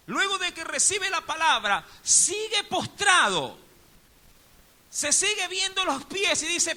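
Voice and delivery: loud, high sermon delivery; full-force preaching. Acted. Preacher driving the point home to the congregation, loud. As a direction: preach it to the rafters.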